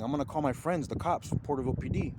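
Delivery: ordinary talk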